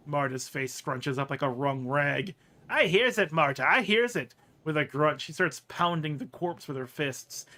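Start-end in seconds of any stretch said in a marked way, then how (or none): 2.7-4.3 louder, lightly voiced indignant reply; Marta. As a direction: agree with yourself, huffily. Marta answers herself indignantly.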